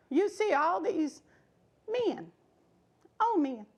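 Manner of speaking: ordinary delivery, nothing marked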